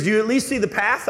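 high, loud pleading question; excited girl. Retold or imitated. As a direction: urge him on, voice up high. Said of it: "She goes".

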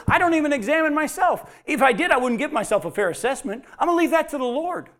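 high, loud declaration; Paul declaring he leaves judgment to God; emphatic Paul. Acted. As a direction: proclaim it boldly. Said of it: "Paul in First Corinthians chapter four said".

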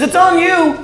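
high, loud, pleading preacher cry; impassioned lament. Acted. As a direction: plead it out, voice climbing. Preacher voicing Jesus' lament, rising.

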